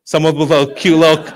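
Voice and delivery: ordinary talk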